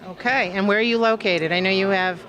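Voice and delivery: normal talking voice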